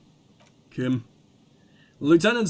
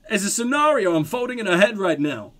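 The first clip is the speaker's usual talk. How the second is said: normal talking voice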